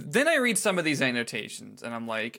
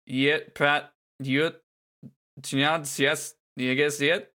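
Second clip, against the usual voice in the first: backwards speech; much slower than usual. Shown as a demonstration. Podcast host reading a lyric backwards for cohosts, mock reversed speech. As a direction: sound out the lyric as if the tape ran backwards. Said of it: "attempt to read the thing in backwards".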